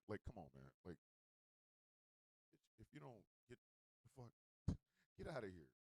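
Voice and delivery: very low, quiet, withdrawn voice; mock sullen refusal. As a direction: play turned off and sulking, barely audible. Acts out sulking refusal to continue.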